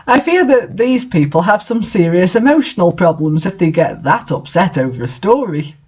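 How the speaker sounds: ordinary talk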